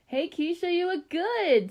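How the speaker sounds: put-on very high, bright call from a girl; Michelle. A narrator's reading. Friend in ghost costume hails Keisha, high and enthusiastic. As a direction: greet a friend in a high singing-out voice. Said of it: "in her friend Michelle's voice".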